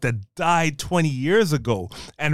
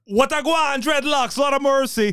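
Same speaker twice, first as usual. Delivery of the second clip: mock Caribbean action hero; 9 semitones higher than usual. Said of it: "a heavy Caribbean accent".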